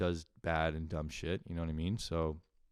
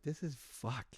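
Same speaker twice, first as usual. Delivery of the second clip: quiet mutter; 6 semitones higher, 10 dB quieter, slower than usual. Retold or imitated. Quiet retold realization.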